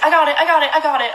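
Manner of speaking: loud, high, insistent; urgent shout. Retold or imitated. Yelling at the owner to hand over the dog, loud and urgent. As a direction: shout it while grabbing the dog back. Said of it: "I was like".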